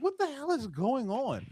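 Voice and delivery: wondering aloud in high-pitched disbelief; retold puzzled reaction; baffled. Retells his own baffled reaction.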